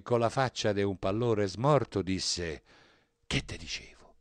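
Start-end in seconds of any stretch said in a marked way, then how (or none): 3.3-4.1 hushed, secretive whisper; frightened whisper. A narrator's reading. Girl hidden in side chapel to her fiancé, whispering. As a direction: whisper it so nobody overhears.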